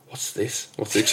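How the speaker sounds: hushed, unvoiced awe; whispered wonder. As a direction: breathe the question in a whisper. Retelling hearing strange music, awed.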